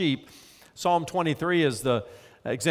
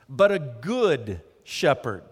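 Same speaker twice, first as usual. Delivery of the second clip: slow down and lean hard on "good"; "good" drawn out, stretched and weighted; slow stress on good; 2 semitones lower, slower than usual. Real, not acted. Preacher contrasts any with good.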